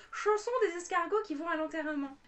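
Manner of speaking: loud, high-pitched declamation; theatrical title announcement. Acted. Vlogger finding her primary-school poem, loud declaiming. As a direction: proclaim it like a schoolchild reciting.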